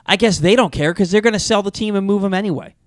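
lightly voiced woman fretting about the team; upset Orioles fan woman. Gym woman fearing the team will move, mock worried. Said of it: "She goes".

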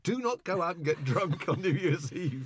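Brian's warning retold slowly, laughing; quoting through laughter. Retelling Brian's New Year's warning to the band, laughing and slow. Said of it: "Brian had said".

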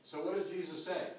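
ordinary talk

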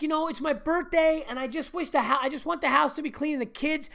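high, weary imitation of the wife's complaint; imitating wife. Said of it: "She's like".

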